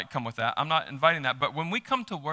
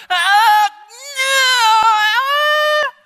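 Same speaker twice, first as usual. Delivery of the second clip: mock strangled shriek, far higher and louder; awkward shout; 12 dB louder, much slower than usual. Pastor mocking people learning to shout, awkward strangled yell. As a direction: imitate a non-shouter's clumsy crying yell. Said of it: "it just sounds really obscure".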